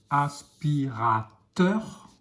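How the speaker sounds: aspirateur stretched syllable by syllable; teacher stretching aspirateur to show the teur syllable; slow syllabified word. Shown as a demonstration. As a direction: draw out as-pi-ra-teur slowly. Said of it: "on entend la syllabe teur".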